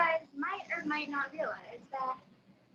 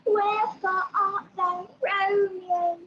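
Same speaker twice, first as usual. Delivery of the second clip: loud, high, theatrical British accent; hammed-up Shakespearean British accent; over-exaggerated British accent; 6 semitones higher, 10 dB louder, much slower than usual. Shown as a demonstration. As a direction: declaim slowly in an overblown British accent. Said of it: "they tend to over-exaggerate".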